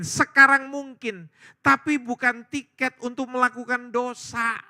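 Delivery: loud, high-pitched impassioned sermon; forceful, elevated pulpit delivery; full-force preaching. Real, not acted. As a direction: preach it loudly to a whole congregation. Preacher driving the message home at full force.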